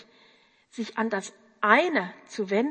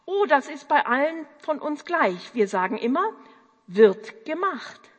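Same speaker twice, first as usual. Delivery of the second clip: put-on boyish eager answer; 5 semitones higher, 5 dB louder than usual. Boy telling Juliane the team motto, eager and crisp. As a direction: answer like a keen young railway worker.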